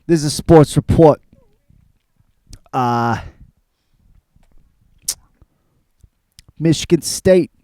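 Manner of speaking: lightly put-on New Jersey guy accent; a hint of a Hoboken sports fan talking; loose, half-held New Jersey accent. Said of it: "I can't keep that fucking accent up".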